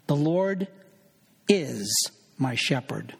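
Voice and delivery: "is" set apart by pauses and punched; stress on is. Shown as a demonstration. Preacher repeating the verse exercise for the congregation, stress on "is". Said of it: "emphasize different words in a given verse".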